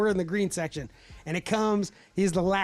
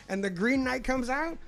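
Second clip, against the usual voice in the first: ordinary talk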